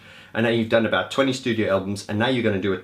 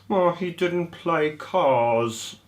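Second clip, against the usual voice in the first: higher, slow mock complaint; disgruntled woman; 7 semitones higher, much slower than usual. Fan leaving the Albert Hall, mock disgruntled and slow. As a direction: drag it out like a sulky fan.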